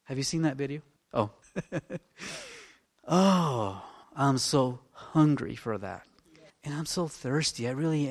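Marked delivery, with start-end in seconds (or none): none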